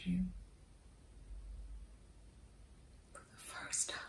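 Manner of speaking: hushed, intimate whisper. Acted. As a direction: barely breathe the words to him. Intimate confession to Wendell.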